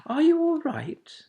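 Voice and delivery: put-on high, caring young woman; young lady comforting a fallen owlet, sweet and high. A narrator's reading. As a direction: ask gently and sweetly. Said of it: "the sweet young voice".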